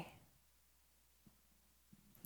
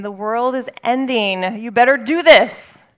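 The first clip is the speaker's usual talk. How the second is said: mock alarm, building louder; fearmonger; 3 semitones higher, 8 dB louder than usual. Preacher voicing fear's pushy alarmist, loud and urgent. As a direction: voice a pushy doomsayer.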